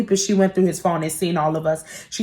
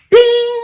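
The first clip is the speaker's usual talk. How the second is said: high, louder sung ding; imitated phone ding; 14 semitones higher, 5 dB louder than usual. Imitating her phone at two in the morning, high ding. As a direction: chime like a text alert.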